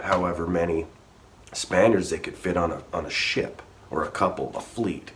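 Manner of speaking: usual speaking voice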